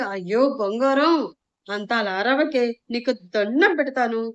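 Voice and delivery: ordinary delivery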